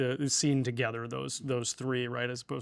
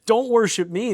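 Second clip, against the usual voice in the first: urgent refusal; 9 semitones higher, 8 dB louder than usual. Retold or imitated. Angel urgently refusing worship.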